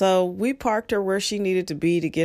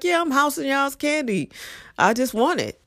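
brazen admission; 9 semitones higher than usual. Pretend. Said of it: "Just be like".

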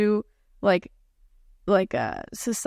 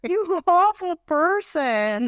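shocked playful scolding, stretched slow; mock outrage; 8 semitones higher, much slower than usual. Real, not acted. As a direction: drag out a scandalized stop. Friend jokes about morbid topic.

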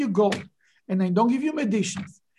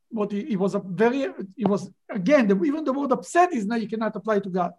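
normal talking voice

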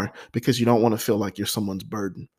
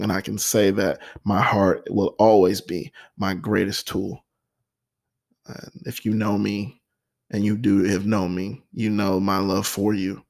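usual speaking voice

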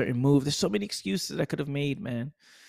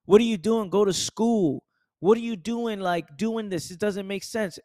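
high, nagging questions, pitched up; doubting relatives; 6 semitones higher than usual. Retold or imitated. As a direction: nag him about getting a job. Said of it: "everybody around me saying".

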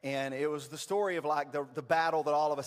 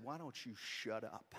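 slow, hushed and low retort; low, deliberate put-down; 5 semitones lower, 10 dB quieter, slower than usual. Retold or imitated. Dad to photographer after their argument, quietly cutting. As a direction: drop soft and low, word by word. Retold snap at photographer, eye to eye.

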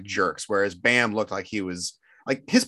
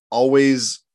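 ordinary talk

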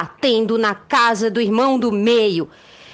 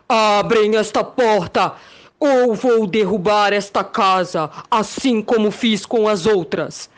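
big bad wolf; 2 semitones lower than usual. Wolf threatening the pigs.

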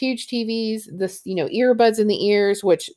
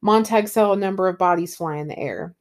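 ordinary talk, nothing marked

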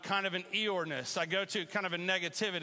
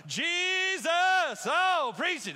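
pastor impersonation; 10 semitones higher, 7 dB louder, much slower than usual. Retold or imitated. Pastor at his church calling out in preaching fervour. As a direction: lift it up like a fired-up pastor. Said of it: "I do an impersonation".

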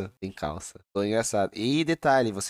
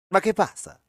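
playful Italian accent impression; 7 semitones higher than usual. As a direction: toss off a light Italian accent. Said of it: "quando vai imitar um italiano".

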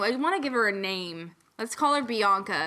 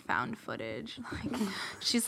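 normal talking voice

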